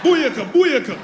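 Jamaican cheer. Retold or imitated. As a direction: shout it like a dancehall hype call. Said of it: "Jamaican women".

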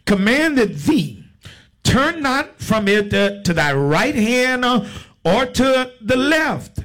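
slow, chunked Bible declamation; preacher stretching out the verse; scripture reading. Real, not acted. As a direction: proclaim each phrase, with pauses between. Reads scripture to congregation.

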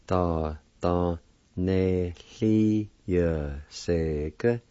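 "Do do Ne Li Ya Se Ge" drawn out slowly; slow careful. Shown as a demonstration. Teacher sounding the word out slowly for students. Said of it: "It's pronounced like this".

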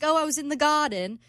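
put-on amateur British accent. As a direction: put on British vowels, badly. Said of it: "You have a terrible British accent".

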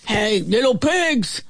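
mild mock bellow; wolf's bellow. A narrator's reading. Wolf calls to the pigs, a restrained bellow. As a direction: call out as a storybook wolf. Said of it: "the wolf bellowed".

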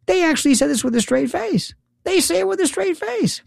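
high-pitched disbelief; incredulous. Real, not acted. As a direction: squeak it out in amazement. Incredulous at what they say.

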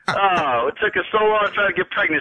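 ordinary talk

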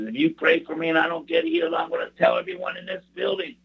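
high-pitched mouthy challenge; imitating Lefty. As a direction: push the voice way up for a loudmouth. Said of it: "And that's how he talked".

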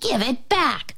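put-on an understated shriek from an old witch; mild witch's shriek. A narrator's reading. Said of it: "shrieked the old witch".

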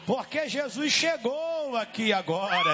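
forceful revival preaching; fiery exorcism sermon. Real, not acted.

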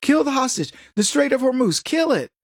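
casual SWAT taunting the hostage taker; mock careless dare. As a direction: shrug it off and dare him. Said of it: "swat says".

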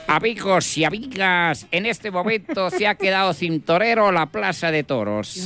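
mock announcer. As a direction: address the bullring crowd. Announcer in bullring. (voz de locutor de plaza)

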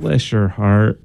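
slow, sweet Southern put-down; sugar-coated dismissal, slow; a slow, sweetly condescending Southern "bless your heart"; polite put-down. Shown as a demonstration. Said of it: "in a very polite way writing you off".

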